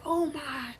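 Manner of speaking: soft wonder, fading into a whisper; hushed amazement. Pretend. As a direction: breathe it out like a secret revelation. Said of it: "you gonna be like".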